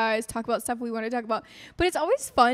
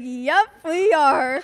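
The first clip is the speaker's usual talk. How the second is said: drawn-out deadpan; 3 semitones higher, 8 dB louder, much slower than usual. Retold or imitated. Deadpan reply quoted.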